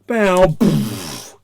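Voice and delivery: loud, explosive impact noise; booming sound effect; imitated crash. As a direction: act out a smash and burst. Imitating lorry hitting drone.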